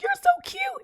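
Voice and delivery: put-on extremely high squeaky baby talk. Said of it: "I was like".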